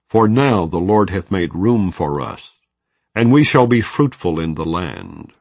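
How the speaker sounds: usual speaking voice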